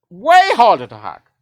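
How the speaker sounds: emphatic repeat. Shown as a demonstration. Correcting a viewer about hacking, a loud slow emphatic repeat. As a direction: hammer the point home.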